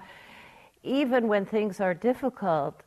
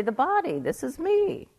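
higher, pleased with itself; mock naive voice; 8 semitones higher than usual. Teacher mimicking someone who thinks the body is the self, mockingly.

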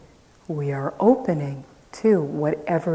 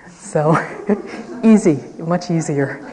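laughing; 7 dB louder than usual. Real, not acted. Retreat teacher joking to the women about keeping silence, laughing. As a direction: laugh between the words, amused by your own aside.

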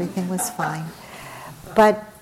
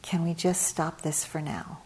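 softer, level retold request; not shouting; 7 dB quieter than usual. Mother stepping into the family squabble, not shouting. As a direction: say it quietly, without any push. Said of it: "in a way that wasn't shouting".

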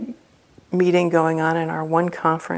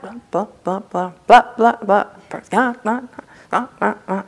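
imitated rant in raised, bouncing nonsense syllables; mock angry tirade; 4 semitones higher than usual. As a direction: rattle off a mock scolding. Mimics woman's angry tirade.

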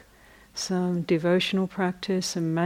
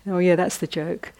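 ordinary delivery

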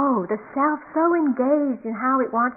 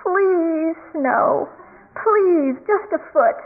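drawn-out, raised-pitch mock begging; gritted-teeth plea; 6 semitones higher, 4 dB louder, much slower than usual. Cartoon boy begging the sky for snow, strained mock plea. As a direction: plead through gritted teeth, playing it up. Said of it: "gritting his teeth and he says".

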